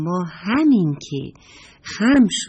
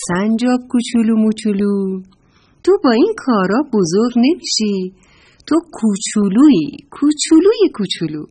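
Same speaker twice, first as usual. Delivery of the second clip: louder, higher sing-song taunt; teasing; 4 semitones higher, 6 dB louder than usual. A narrator's reading. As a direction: tease the little one. Bear laughs, teases little squirrel.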